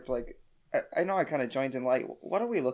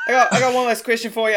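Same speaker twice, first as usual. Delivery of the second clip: loud, raised insistence; yelling over; 9 semitones higher, 9 dB louder than usual. Acted. A player yells over the other to question the king.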